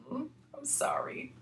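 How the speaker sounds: whispered, sheepish apology; embarrassed whisper. Retold or imitated. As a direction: whisper the sorry as if caught out. Embarrassed reply to unrecognized woman.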